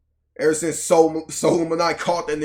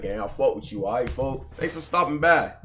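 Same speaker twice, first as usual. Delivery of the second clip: ordinary talk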